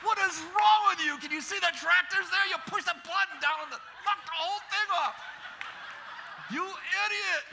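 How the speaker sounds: high-pitched, loud exasperated tirade; hammed-up yelling at a careless kid; inner furious rant. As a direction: let the bottled-up rage out high and loud. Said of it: "That's what I was thinking".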